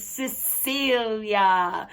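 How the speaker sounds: Cecilia said slowly for learners; Lenape word demo. Shown as a demonstration. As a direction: model Cecilia slowly, stretching the syllables. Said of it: "the word in Lenape for buffalo is".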